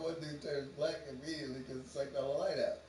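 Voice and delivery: ordinary talk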